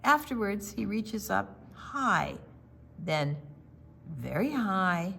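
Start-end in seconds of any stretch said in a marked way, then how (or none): none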